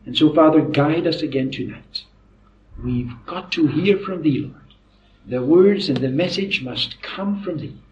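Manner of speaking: low, soft, reverent prayer with pauses. Real, not acted. Preacher asking God to guide them tonight, hushed prayer. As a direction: pray softly, pausing between phrases.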